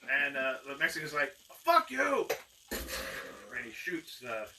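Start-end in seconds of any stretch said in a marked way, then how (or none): 1.6-2.3 loud, high-pitched angry yell; defiant shout. Acted. Gunman answering the magician before he shoots, defiant shout. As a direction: hurl it back in fury.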